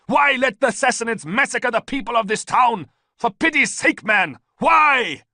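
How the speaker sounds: loud, high furious shouting; roaring. A narrator's reading. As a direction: demand an answer at full voice. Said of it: "Ballista roared".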